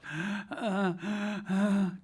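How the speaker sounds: a sharp gasp of first breath; reenacting a rescued girl's first gasp; imitated gasp. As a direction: gasp in like a lung refilling. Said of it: "one of the most beautiful sounds".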